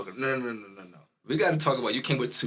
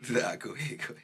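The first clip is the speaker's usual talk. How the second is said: whispered, egging him on; whispering; 6 dB quieter than usual. Real, not acted. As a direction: breathe it out as a whisper. Egging friend on.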